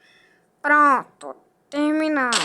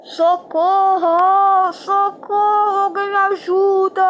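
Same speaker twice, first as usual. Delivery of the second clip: shouting for help; 5 semitones higher, 6 dB louder, slower than usual. Acted. Cameraman in trouble, calling help.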